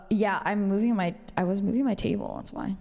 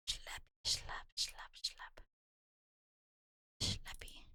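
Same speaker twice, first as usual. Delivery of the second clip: soft unvoiced whisper; 8 dB quieter than usual. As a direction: whisper it like a lullaby. Whispering to sleepy mom.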